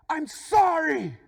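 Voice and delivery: high, loud, drawn-out yell; angry apology. Retold or imitated. Driver apologises with his anger, like men do, angry apology. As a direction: bellow an apology as if picking a fight. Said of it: "with my anger".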